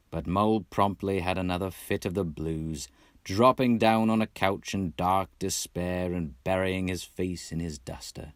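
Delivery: ordinary delivery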